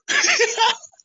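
loud high laughing burst; burst of laughter. Real, not acted. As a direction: laugh out loud mid-story. Laughing at own disguise.

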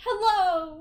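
loud, high, preppy hello; preppy work greeting. Shown as a demonstration. At work, greeting a customer in preppy style. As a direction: sing out hello like a peppy cashier. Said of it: "at work you have to be all preppy".

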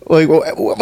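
sputtering coffee through a laugh; spitting out coffee, laughing; spit take. Real, not acted. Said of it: "coffee spit all over".

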